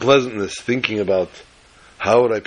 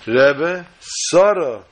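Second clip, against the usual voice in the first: insistent calling; 5 semitones higher, 4 dB louder, much slower than usual. Retold or imitated. Child calling unresponsive teacher again.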